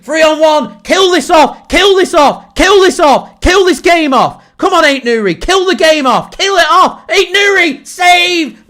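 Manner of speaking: loud, high, pleading yells at the team; urgent shouting. Real, not acted. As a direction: roar them on to finish it. Fan urging team to finish game.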